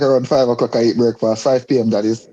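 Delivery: normal talking voice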